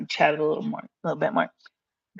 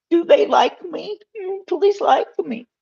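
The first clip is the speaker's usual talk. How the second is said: high, begging little voice asking for likes; mock pleading; 11 semitones higher, 4 dB louder than usual. Real, not acted.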